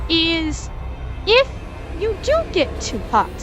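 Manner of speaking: ordinary talk, nothing marked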